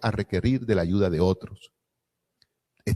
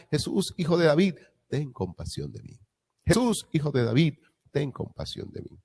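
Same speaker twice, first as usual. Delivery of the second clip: pleading cry; 7 semitones higher than usual. Retold or imitated. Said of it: "empezó a gritar".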